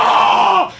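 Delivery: high, loud mock yelp of alarm; startled cry, acted. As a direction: act out a panicked "ah". Acting out his startled reaction.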